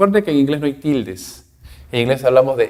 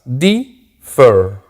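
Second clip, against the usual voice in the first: DIFFER split into two beats, di, fer; syllable by syllable. Shown as a demonstration. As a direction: pause between the syllables of "Differ". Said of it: "Differ tiene dos sílabas".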